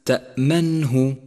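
slow melodic تأمنه; correct hamza. Shown as a demonstration. Teacher chanting the correct hamza. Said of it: "احذر من اللحن".

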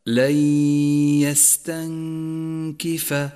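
"لن يستنكف" chanted, n merged into a long nasal y; merging with ghunna. Shown as a demonstration. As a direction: hold the nasal glide in "لن يستنكف". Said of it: "فيصير اللفظ هكذا". («لن يستنكف» بإدغام النون في الياء بغنة)

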